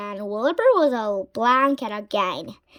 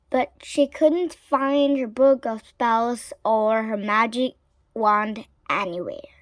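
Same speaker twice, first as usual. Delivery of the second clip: ordinary delivery, nothing marked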